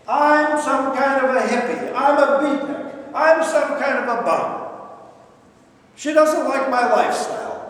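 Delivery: imitating an angry mother ranting. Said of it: "she starts ranting and raving".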